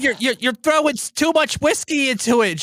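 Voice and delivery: high, alarmed mother scolding; mother scolding grandma over the whiskey. Retold or imitated. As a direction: imitate a fussing mom, high and urgent. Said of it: "she would be like".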